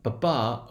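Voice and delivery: "papa" with a light first syllable and a stressed second. Shown as a demonstration. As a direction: beat out "papa" as weak-STRONG, landing on the last syllable. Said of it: "a one stress pattern".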